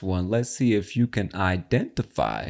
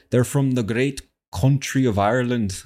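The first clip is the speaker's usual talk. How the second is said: put-on higher-pitched, rough stab at an Irish accent; attempted Irish accent; 4 semitones higher, 3 dB louder than usual. Host reveals the clip's origin, in attempted Irish accent. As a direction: have a go at Irish, not quite managing it. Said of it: "I can't really do that accent".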